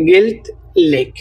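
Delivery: গেলথলেক drawn out syllable by syllable; teacher slowing গেলথলেক right down for the class; slow spelled-out demo. Shown as a demonstration. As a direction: stretch গেলথলেক slowly so learners catch each sound. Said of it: "এবং স্ত্রীবাচক হচ্ছে".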